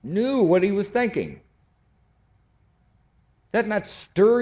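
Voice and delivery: impassioned preaching. Real, not acted. Preacher stirring the congregation, high and fervent. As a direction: preach it with rising fire.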